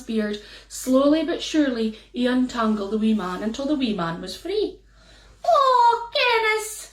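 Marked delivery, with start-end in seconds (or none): 5.4-6.9 much higher, a touch louder fairy voice; fairy man thanking Sandy, high and relieved. Acted. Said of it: "said the wee man".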